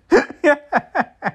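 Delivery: high, real burst of laughter; helpless laughter. Real, not acted. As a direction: laugh so hard you could cry. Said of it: "I'm fucking crying how funny it is".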